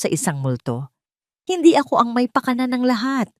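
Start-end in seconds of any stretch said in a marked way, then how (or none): 1.5-3.3 higher, desperate denial; Argus pleading. A narrator's reading. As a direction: shift the blame in panic. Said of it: "patuloy ni Argus". (nagmamakaawang pagtanggi)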